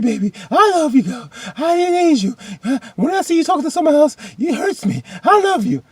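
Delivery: very high, mock-tearful begging; hammed-up pleading. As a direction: plead desperately in a squeaky wail, overdone. Acts Isaiah begging forgiveness.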